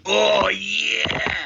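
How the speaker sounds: high, drawn-out mock roar; mock fan cheer. Mocking FIFA fans at the esports segment, hammed-up cheer. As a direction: bellow like an overexcited FIFA fan. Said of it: "the fifa twats are going".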